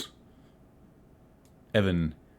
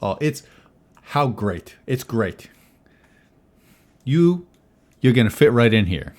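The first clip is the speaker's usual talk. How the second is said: lightly raised, hearty host voice; hint of a jovial innkeeper; 3 semitones higher, 5 dB louder than usual. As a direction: welcome her like a slightly pushy host. Tony welcomes the newcomer.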